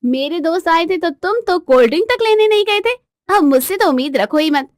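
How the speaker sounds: indignant scolding wife; aggrieved wife. Acted. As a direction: throw an old grievance at him. Wife brings up old grievance.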